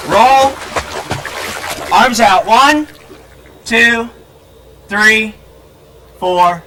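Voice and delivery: loud, high counting calls. Real, not acted. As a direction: shout the count across the pool. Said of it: "I have to raise my voice so she can hear me".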